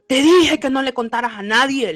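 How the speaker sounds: harsh scolding. Retold or imitated. The duende rebukes the boy for breaking the secret, harsh scolding. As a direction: scold hard, acting out an angry man. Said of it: "me habló feo".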